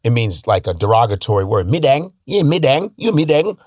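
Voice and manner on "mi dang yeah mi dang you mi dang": 1 mock Vietnamese insult.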